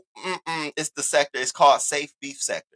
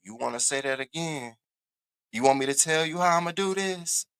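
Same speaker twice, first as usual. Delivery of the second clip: quiet menacing Diva; 8 dB quieter than usual. Retold or imitated. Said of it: "Go ahead, Diva, say it".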